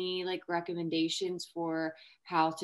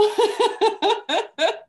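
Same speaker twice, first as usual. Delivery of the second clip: high, loud burst of laughter; bright laughing outburst; 12 dB louder than usual. Real, not acted. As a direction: let out a big real laugh. Laughing at her own story.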